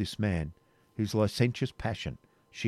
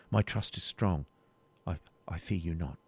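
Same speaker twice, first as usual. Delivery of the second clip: understated fear; 4 semitones lower, 6 dB quieter than usual. Acted. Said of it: "her short-drawn breath seemed to belie".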